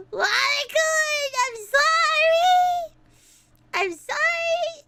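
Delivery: high, slow, drawn-out plea; mock wailing apology. Streamer apologising to the wallet, mock wailing. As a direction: wail it sing-song, stretching the last syllable.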